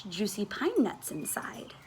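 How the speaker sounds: breathy dreamy whisper. A narrator's reading. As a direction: whisper it longingly. Dreamy whisper of pine nuts.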